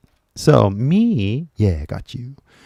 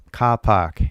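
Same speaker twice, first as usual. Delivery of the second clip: slow Australian "car park", no r's; Australian accent. Shown as a demonstration. Teacher demonstrating an Australian word, Australian accent. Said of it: "maybe they say car just like in England".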